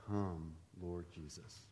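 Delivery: very soft, slow, earnest prayer; sincere prayer. Shown as a demonstration. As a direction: pray it quietly and mean every word. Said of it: "one of the most serious things".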